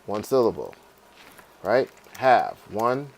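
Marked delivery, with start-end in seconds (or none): none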